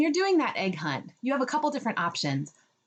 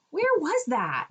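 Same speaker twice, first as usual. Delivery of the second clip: put-on higher, bright question to a child; eager question; 8 semitones higher, slower than usual. Shown as a demonstration. Asking the child where the egg was, eager. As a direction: ask with excited curiosity. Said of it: "request that that child tell you".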